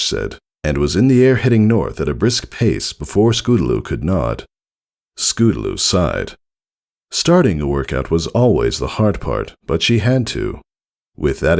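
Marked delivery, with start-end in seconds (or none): none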